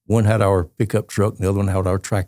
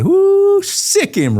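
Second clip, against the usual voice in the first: high, loud whooping crowd cheer; 5 dB louder, much slower than usual. Retold or imitated. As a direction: cheer like a rowdy circus crowd.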